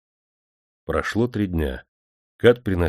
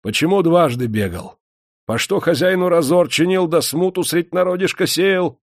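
much higher, louder furious questioning; angry interrogation; 11 semitones higher, 5 dB louder than usual. A narrator's reading. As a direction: demand answers in rage. Master interrogates prisoner angrily.

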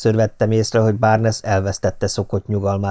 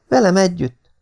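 put-on young woman's voice, pitched much higher and louder; Patricia's voice; 10 semitones higher, 4 dB louder than usual. A narrator's reading. Patricia interjecting to the doctor, high and eager.

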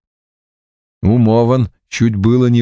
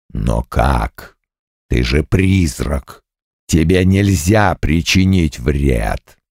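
demon bull voice; 7 semitones lower, slower than usual. Acted.

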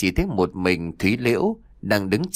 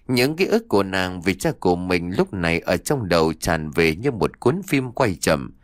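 usual speaking voice

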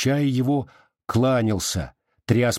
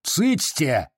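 higher-pitched shushing cry; sharp rebuke; 5 semitones higher than usual. A narrator's reading. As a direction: silence them with one sudden cry. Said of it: "зыкнул Пантелей Прокофьевич". (резкий окрик)

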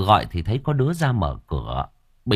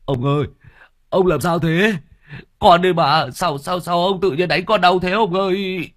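high, loud pained protest; servant crying out; 10 semitones higher, 5 dB louder than usual. Acted. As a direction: yelp like a struck servant. Hit servant cries out.

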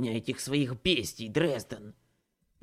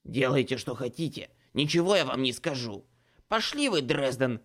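defiant; 6 dB louder than usual. A narrator's reading. Ace defies Dresden.

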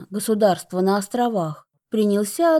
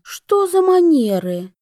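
indignant; 8 semitones higher, 4 dB louder than usual. Acted. Sister scolded on the phone.

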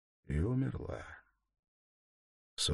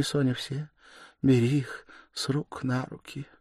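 dying mother, feverish and loud, higher; a dying woman crying out her last bitter words; 8 semitones higher, 11 dB louder than usual. Acted.

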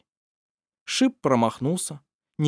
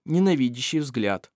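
usual speaking voice

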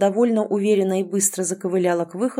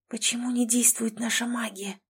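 hushed, soft question; whispering; 3 semitones higher, 7 dB quieter than usual. A narrator's reading. Captive girl secretly asking her friend, whispering. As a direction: ask it under your breath. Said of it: "шёпотом спросила она у друга".

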